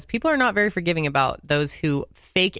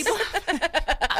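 a high burst of disbelieving laughter; 9 semitones higher than usual. Real, not acted. Laughing at the excuse.

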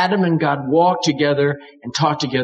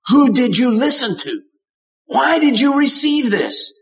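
pressing question; 8 semitones higher than usual. Retold or imitated. God pressing Adam, higher and more urgent. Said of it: "What he means is".

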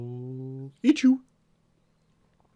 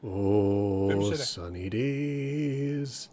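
lower, very slow drowsy voice; sleepy creature; 7 semitones lower, 8 dB quieter, much slower than usual. Acted. A lazing animal in the sun dozes off, slow and drowsy. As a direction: drag the words out, half asleep.

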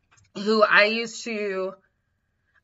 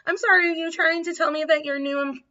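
mock indignant; 7 semitones higher than usual. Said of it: "then I get indignant".

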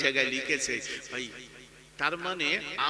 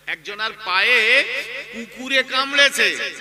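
emphatic repetition; 5 semitones higher, 10 dB louder than usual. Real, not acted. Preacher repeating the dog-bite parable to listeners, loud emphasis. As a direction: hammer the line home, swelling on পায়ে and কামড়েছে.

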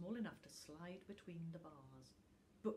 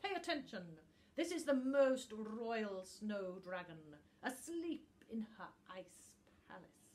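higher, louder lecturing voice; pompous book; 4 semitones higher, 8 dB louder than usual. A narrator's reading. Book lectures little Tuft about the royal dragon, pompous. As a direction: lecture him grandly.

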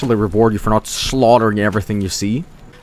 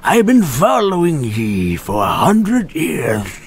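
slow put-on villain voice, building to a shout on "die"; menacing NPC; slower than usual. Gamer imagining a lurking NPC, slow menacing villain voice. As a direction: creep up then strike. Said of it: "an N P C behind me to be like".

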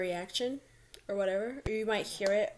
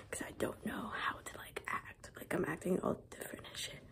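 whispering; 2 semitones lower, 8 dB quieter than usual. Real, not acted. Whispering in doctor's bathroom.

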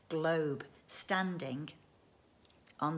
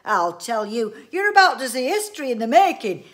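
very loud, raised grand announcement; excited roar; 5 semitones higher, 15 dB louder than usual. A narrator's reading. Inventor promises his wife history, roaring with excitement. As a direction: boom it out like a great promise. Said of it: "roared Mister Winsborough".

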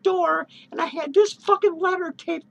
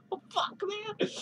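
soft words through a laugh; laughing quietly; 4 semitones higher, 11 dB quieter, slower than usual. Real, not acted. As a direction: still laughing, much softer. Laughing after giggle.

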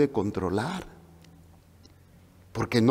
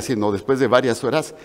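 ordinary talk, nothing marked

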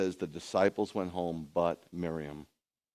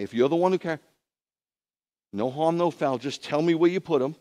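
higher, louder, urgent pleading; distraught woman, acted; 4 semitones higher, 5 dB louder than usual. Miriam begs the supposed gardener for the body, urgent pleading. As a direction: press him for an answer, lightly frantic. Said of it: "she's going crazy".

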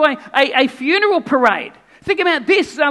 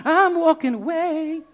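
ordinary talk

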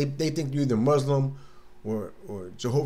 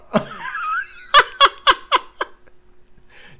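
loud high-pitched laugh; high squealing laugh; 7 dB louder than usual. Real, not acted. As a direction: let out a big squeal of laughter. Big laugh.

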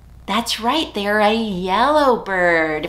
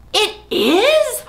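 high, mock-amazed question; surprised; 13 semitones higher, 6 dB louder than usual. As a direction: gasp out the question, delighted. Mock surprise at puppet's news.

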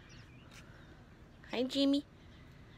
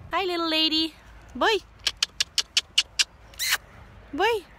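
excited greeting; 9 semitones higher, 7 dB louder than usual. Real, not acted. Greeting the animals.

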